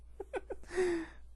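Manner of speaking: real high giggle; helpless little laugh. Real, not acted. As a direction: crack up at your own silly voice. Cracks up at own voice.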